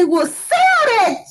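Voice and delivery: high, drawn-out granddad retort; emphatic granddad. Retold or imitated. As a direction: stretch it out as granddad's punchline. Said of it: "that's what my granddad would say".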